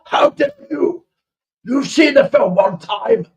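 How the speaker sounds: high, loud posh indignation, settling as it goes; hammed-up affronted posh English gentleman; faux posh outrage. Posh master ordering the boy to polish silver, mock indignant. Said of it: "but faux posh".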